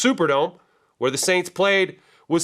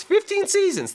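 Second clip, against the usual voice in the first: high-pitched disbelief on FIFTEEN SEASONS; amazed emphasis. Real, not acted. Host marvelling at a coach's long tenure, high-pitched amazement. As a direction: squeal the figure out in amazement.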